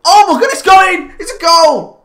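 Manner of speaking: goal celebration. Real, not acted.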